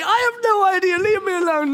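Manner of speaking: high, frantic fed-up outburst; strained high cry; exasperated dad. Acted. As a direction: lose patience with pestering kids, voice soaring. Harried dad losing patience with kids.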